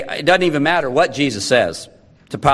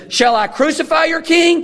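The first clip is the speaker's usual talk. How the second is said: much higher, mock-incredulous question; sarcastic; 12 semitones higher than usual. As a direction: ask it with pointed sarcasm, pitch climbing high. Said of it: "his words drip with sarcasm".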